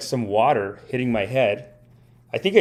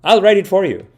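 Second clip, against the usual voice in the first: raised, loud God voice; 8 semitones higher, 7 dB louder than usual. Acted. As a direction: play God making a generous offer, louder and higher. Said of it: "And then God says".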